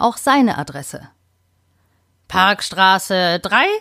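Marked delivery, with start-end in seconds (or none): 2.3-3.7 slow, lightly croaked "Parkstraße drei"; a budgie rasping out its address slowly; croaking parrot. Acted. Budgie croaks its address, slow light rasp. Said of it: "krächzte Bobby".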